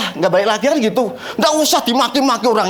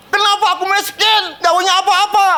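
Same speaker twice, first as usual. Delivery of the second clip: high, whining complaint; whiny complaint; 9 semitones higher than usual. Retold or imitated. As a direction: mimic a bitter woman. Woman complains about being poor. (rengekan tinggi yang ditirukan)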